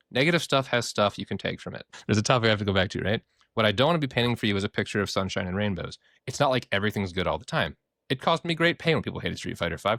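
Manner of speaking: ordinary delivery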